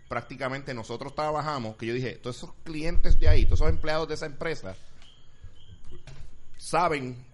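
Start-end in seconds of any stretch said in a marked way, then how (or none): 2.1-7.3 much louder, emphatic retelling; retold outburst. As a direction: raise the volume to make the point. Retelling his own outburst.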